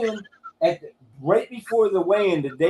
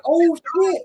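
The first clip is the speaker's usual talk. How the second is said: retold excited reaction; 8 semitones higher, 3 dB louder than usual. Retells own reaction watching the fight.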